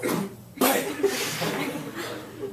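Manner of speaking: high burst of laughter breaking into the story; sudden high laughing fit; big laugh. Real, not acted.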